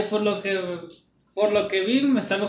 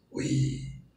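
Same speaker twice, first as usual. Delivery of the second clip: low, slow whispered monster voice; Venom voice; 7 semitones lower, 4 dB quieter, much slower than usual. Retold or imitated. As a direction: rasp it low like a symbiote. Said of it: "diciendo que".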